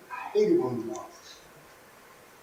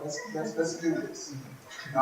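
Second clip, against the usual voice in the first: ordinary delivery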